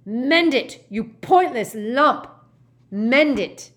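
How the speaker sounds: shouted insult and order, drawn out on the abuse; furious scream. A narrator's reading. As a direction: scream it, high and very loud. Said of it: "Gaunt screamed".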